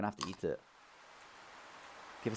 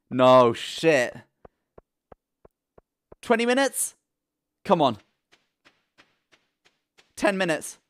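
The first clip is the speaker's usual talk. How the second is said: louder, higher panicky cries; panicked outburst; 5 semitones higher, 9 dB louder than usual. Real, not acted. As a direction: yell at the game clock in alarm. Panicking at the clock.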